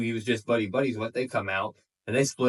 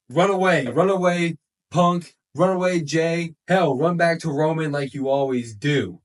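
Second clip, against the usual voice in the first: loud, higher-pitched taunting yell; imitated yelling; 5 semitones higher, 5 dB louder than usual. Wrestler yelling at rivals leaving the ring, imitated yell. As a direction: yell it after them, acting out the wrestler. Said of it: "what does he yell?".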